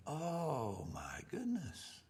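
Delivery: hushed, breathy oh my goodness; whispered dismay. Retold or imitated. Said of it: "And I'm thinking".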